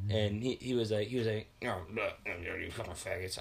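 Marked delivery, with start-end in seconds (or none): 1.6-3.4 low, soft mimicked muttering; mock under-the-breath insult; mumbled backing down. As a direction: imitate someone grumbling an insult as they retreat. Acting driver's backing-down muttered insult.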